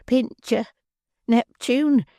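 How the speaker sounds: high, shaky calling of dogs; tremulous calling. Acted. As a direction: call the dogs with a quaver. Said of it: "cried a tremulous voice".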